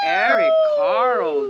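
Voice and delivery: a sudden high, loud cheer for the right answer; excited praise. Real, not acted. Praises the kids for naming the author, a high loud cheer.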